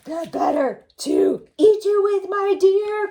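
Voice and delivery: loud, high pouncing roar; wolf's cry. Acted. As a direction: leap on her with a roar. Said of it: "cried the wolf".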